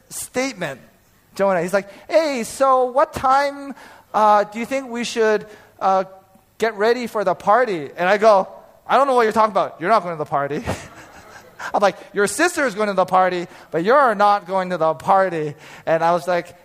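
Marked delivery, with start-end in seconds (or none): 2.1-7.9 put-on innocent kid. Son asking about the party. 8.9-15.5 louder, higher, firm scolding father; stern dad. Retold or imitated. Father shutting son down.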